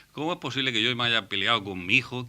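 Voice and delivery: ordinary talk, nothing marked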